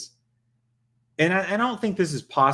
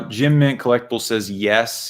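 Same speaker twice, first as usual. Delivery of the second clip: ordinary talk, nothing marked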